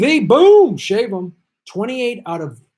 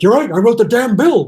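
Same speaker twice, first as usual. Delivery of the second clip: higher, louder put-on voice of a politician caving in; mocking impression; 7 semitones higher, 7 dB louder than usual. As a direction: sneer it out as the other guy, pitched up and loud. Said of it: "When he's like".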